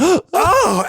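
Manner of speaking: high, loud campy exclamation; old queen. Retold or imitated. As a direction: imitate an aged queen thrilled by a find. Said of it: "eighty-year-old queen".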